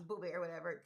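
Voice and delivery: usual speaking voice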